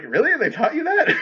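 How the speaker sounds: high, laughing mock surprise; sarcastic disbelief. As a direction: scoff at it with disbelief.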